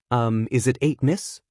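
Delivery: usual speaking voice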